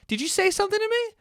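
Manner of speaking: mock mom startled by a beep; imitating confused mom. Mom imitated during hearing test.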